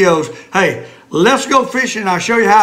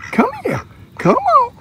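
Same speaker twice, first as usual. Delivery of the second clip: very high-pitched call to ducks; coaxing animals. Real, not acted. Fisherman beckons the ducks, high coaxing call. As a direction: coax them closer way up high.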